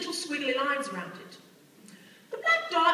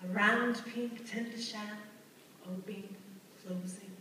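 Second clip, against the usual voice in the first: soft, hushed, measured recitation; low, quiet voice sinking away phrase by phrase; poetic recital; 4 semitones lower, 8 dB quieter than usual. Acted. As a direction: recite it like a tender poem, with pauses. Recites a lyrical description.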